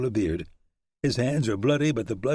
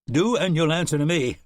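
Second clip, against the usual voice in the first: higher, louder lightly voiced ranger warning; a hint of a firm lawman; mild stern warning; 7 semitones higher, 5 dB louder than usual. Shannon warning the horseman off, stern.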